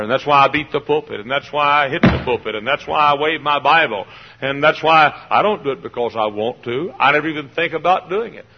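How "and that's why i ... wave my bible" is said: normal talking voice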